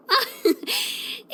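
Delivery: short high giggle; amused laugh. Acted. Picturing a giant top hat outside the shop, amused giggle. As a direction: giggle at the silly idea.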